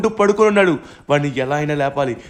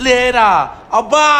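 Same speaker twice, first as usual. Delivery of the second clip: higher, louder short yell; shout; 5 semitones higher, 5 dB louder, slower than usual. Acted. Yelling at the titan to wake up, loud. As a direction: bark the word.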